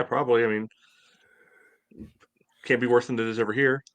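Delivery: ordinary delivery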